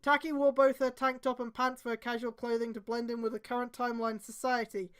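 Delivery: ordinary talk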